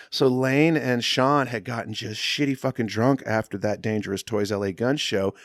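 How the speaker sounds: normal talking voice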